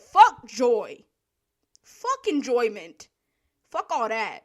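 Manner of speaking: loud, punched imitation of the boss; mock angry rant. Mocking the store manager who shut down the game, loud and harsh. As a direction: bark out each fuck like a killjoy. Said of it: "This motherfucker said".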